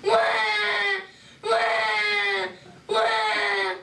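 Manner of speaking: loud high mock baby cries; hammed-up crybaby wail; principal wailing like a baby. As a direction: grown man bawling like an infant. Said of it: "he yelled".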